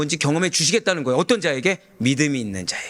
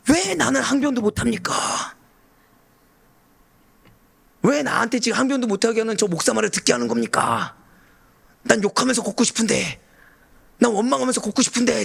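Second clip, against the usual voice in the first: mock aggrieved complaint, pitched up; protesting believer; 8 semitones higher than usual. Preacher mimics believers complaining to God, aggrieved protest. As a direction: whine in protest, as the congregation would. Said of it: "근데 우리는 뭐라고요".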